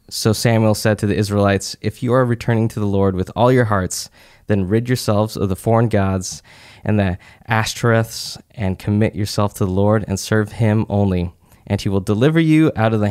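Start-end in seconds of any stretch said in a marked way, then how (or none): none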